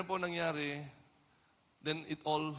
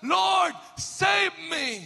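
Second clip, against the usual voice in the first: higher, louder, slow desperate shout of "Lord, save me"; crying out; 14 semitones higher, 12 dB louder, much slower than usual. Retold or imitated. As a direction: stretch it out like a sinking man calling for help. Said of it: "Siya po ay sumigaw". (sumisigaw na paghingi ng saklolo)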